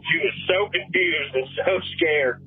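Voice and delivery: plain reading voice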